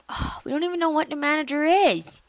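ordinary talk

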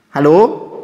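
loud call into the dark. Real, not acted. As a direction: shout hello into the empty hall. Calling into the dark castle.

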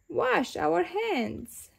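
drawn-out, slow reminder. A narrator's reading. Mommy Rabbit reminding children, slow and deliberate. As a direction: stretch the words out.

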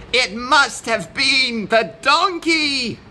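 high-pitched accusing yell. A narrator's reading. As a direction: exclaim it as an accusing goat. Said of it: "yelled the goat".

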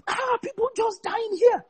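high, slow, incredulous retold cry; high and slow, as if asking the devil himself; imitating bewildered outrage. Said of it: "I was like".

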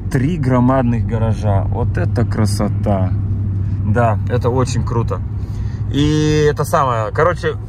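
ordinary talk, nothing marked

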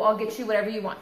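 usual speaking voice

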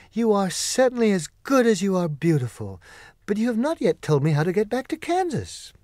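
put-on bright grateful girl pleading to go home; Dorothy voice.

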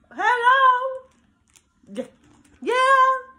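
high, loud mock hype; hyped-up cheer. Playing a fan cheering her channel's name, loud hype.

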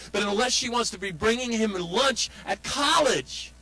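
high, emphatic, stretched key words; impassioned outburst. Real, not acted. Speaker tells parents how hard this is for mom, impassioned. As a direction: exclaim it with mounting indignation on mom's behalf.

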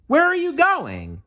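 high nephew call; calling after him. A narrator's reading. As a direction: call out as he runs off. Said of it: "his nephews called".

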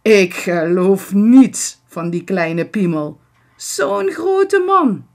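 uitroep, luid en steeds hoger; luid roepend. A narrator's reading. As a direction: roep het vol ongeloof uit. (luid roepen)

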